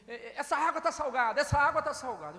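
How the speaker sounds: put-on possessed girl throwing the water, loud raging cry; possessed girl's cry. Possessed girl voiced in story.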